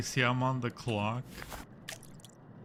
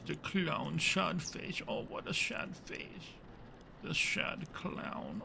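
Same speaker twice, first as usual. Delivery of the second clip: mock pity; 6 semitones higher, 5 dB quieter than usual. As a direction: whisper sadly over the clown. Whispered pity for clown.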